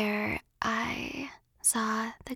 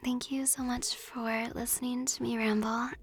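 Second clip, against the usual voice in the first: whispering; 4 dB louder than usual. Real, not acted. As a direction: whisper close to the mic. ASMR chat whispered throughout.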